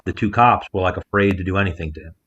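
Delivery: ordinary talk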